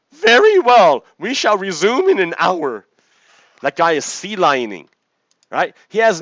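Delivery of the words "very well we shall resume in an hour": high, loud, fake-polite sea lion. Sea lion answers the annoyed man, high and loud. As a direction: squeak it out with mock courtesy. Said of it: "sea lion leaves".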